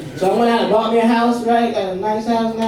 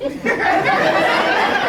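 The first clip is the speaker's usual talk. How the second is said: high unvoiced laugh; 12 semitones higher than usual. Real, not acted. Comic laughs at own bit.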